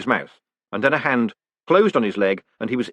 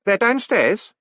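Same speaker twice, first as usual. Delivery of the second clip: put-on much higher, slow hesitant boy voice; a nervous grin you can hear; uncertain boy; 12 semitones higher, 3 dB louder, slower than usual. A narrator's reading. Stanley telling Moist about the men, uncertain boy voice.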